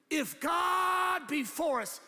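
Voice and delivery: slow, high, loud proclamation; booming declaration. Shown as a demonstration. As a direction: belt out the verse with long drawn-out words. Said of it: "some of you guys read it in this voice".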